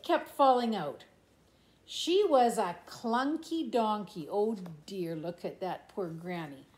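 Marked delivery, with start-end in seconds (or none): none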